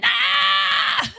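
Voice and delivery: sudden high shout; mock preacher shout. Shown as a demonstration. Preacher showing how loud he comes in, sudden shout. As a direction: blast it out to shake the calm room. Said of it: "And I come in".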